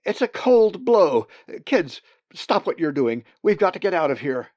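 put-on high, loud frightened call to the kids, quickening at the end; mother raven shouting at the young to flee the storm; urgent alarmed warning. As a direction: yell the warning, rushing the last words. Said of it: "she cried".

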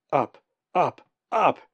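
loud, mounting exclamations; urgent climbing cry. A narrator's reading. Colin urging himself upward in flight.

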